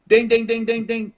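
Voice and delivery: imitated bell.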